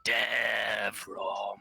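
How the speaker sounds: a hint of a ghostly voice calling out; lightly voiced smoky spirit; spirit calls a name. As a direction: call the name from the smoke.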